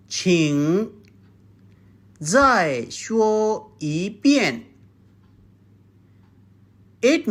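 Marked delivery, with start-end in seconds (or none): 0.1-4.6 再说一遍 said slowly and clearly; slow model phrase. Shown as a demonstration. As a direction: say it slowly so students can copy. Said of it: "number seventh phrase is".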